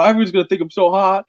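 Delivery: ordinary talk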